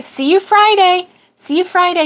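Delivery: ordinary talk, nothing marked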